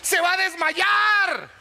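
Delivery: loud, slow, drawn-out punchline; shouted punchline. Real, not acted. Preacher to the congregation, a loud slow shouted punchline.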